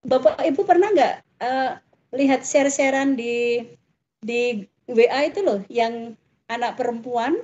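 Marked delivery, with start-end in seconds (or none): none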